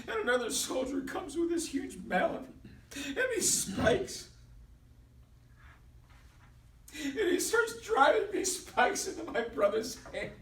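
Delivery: high, strained voice near tears; anguished telling. Acted. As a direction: tell it as if watching your brother be tortured. Brother recounts the nailing in distress.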